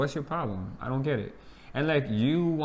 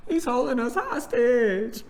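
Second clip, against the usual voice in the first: laughing high; 10 semitones higher, 4 dB louder, slower than usual. Real, not acted. Laughing joke about baby.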